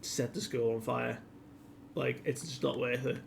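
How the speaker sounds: understated weeping order; mildly tearful. Retold or imitated. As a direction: quietly give up, holding back tears. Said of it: "[name] is in floods of tears".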